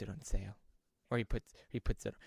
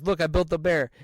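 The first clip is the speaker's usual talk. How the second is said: loud, higher, hammed-up pride; proud announcement; 6 semitones higher, 10 dB louder than usual. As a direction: show off the bear loudly. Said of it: "he goes".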